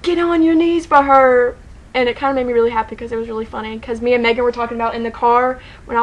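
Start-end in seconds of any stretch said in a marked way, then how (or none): 0.0-1.6 slow, higher mock command; Harry. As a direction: call it out in a drawn-out put-on voice. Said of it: "Harry said".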